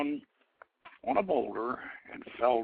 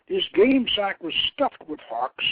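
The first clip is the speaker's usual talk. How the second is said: plain reading voice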